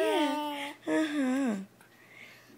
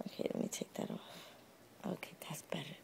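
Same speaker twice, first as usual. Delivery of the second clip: whisper; 12 semitones lower, 14 dB quieter, faster than usual. Real, not acted. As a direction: whisper so you don't startle her. Parent whispering near baby.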